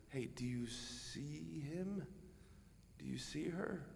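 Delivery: soft, slow, tender question; gentle voice of the Lord. Acted. The Lord gently asking the listener, soft and tender. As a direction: ask softly, inviting them to look. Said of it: "the Lord's gonna ask you".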